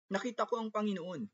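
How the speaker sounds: high-pitched, eager news; excited announcement. A narrator's reading. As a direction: announce it high, as Maria to the disciples. Maria announces news to the disciples. (mataas at sabik na pagbabalita)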